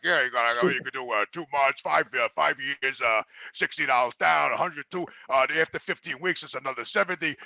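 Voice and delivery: low, quiet, mumbled salesman voice; imitating the salesman. Gym clerk listing prices to Jimmy, low and mumbling.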